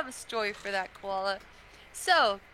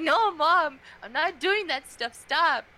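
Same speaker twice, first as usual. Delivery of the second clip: higher, louder retold denial to a parent; acted-out indignant pushback; exasperated protest; 6 semitones higher, 6 dB louder, slower than usual. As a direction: protest like a teen fending off mom. Retold argument with mom.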